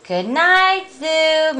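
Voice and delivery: high, drawn-out goodnight; zookeeper's wife. Acted. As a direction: call a high storybook goodnight. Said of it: "Missus Zookeeper says".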